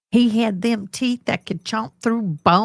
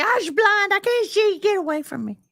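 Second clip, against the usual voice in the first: high put-on character voice; imitating Johnny; 13 semitones higher than usual. Johnny rejecting someone he doesn't like, high and squeaky. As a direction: brush them off in a squeaky voice. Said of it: "If he didn't like you".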